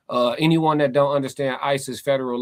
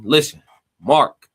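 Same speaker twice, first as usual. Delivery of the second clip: firm call-out; 8 dB louder, slower than usual. Real, not acted. Streamer scolding chatter Mark.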